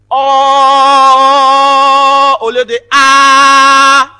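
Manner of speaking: long loud held OH, then open A; rounded vowel demo. Shown as a demonstration. Teacher showing a class two held vowels, rounded then natural. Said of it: "on prononce le A et puis le O".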